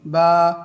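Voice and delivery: loud model of the letter বা; Arabic letter ba. Shown as a demonstration. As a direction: call out বা as a clear model. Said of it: "স্বাভাবিকভাবে ঠোঁট যেরকম থাকে সেরকম থেকে একটু খুলে".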